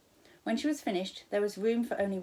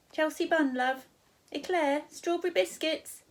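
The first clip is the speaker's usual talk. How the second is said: high, louder friendly stall lady; cheery shop woman; 9 semitones higher, 5 dB louder than usual. Acted. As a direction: sing out the wares like a cheery server. Counter woman lists food.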